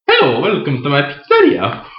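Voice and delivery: a hint of a hammed-up Italian host; lightly voiced pizza man greeting guests; Italian pizzeria owner. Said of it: "I was Mario, the owner of a pizzeria".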